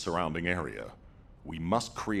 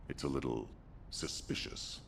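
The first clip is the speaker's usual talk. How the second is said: lower, hushed and wary; quiet suspicion; 4 semitones lower, 8 dB quieter than usual. Acted. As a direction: drop the voice, hesitating before the last word. Voicing suspicion about the guide.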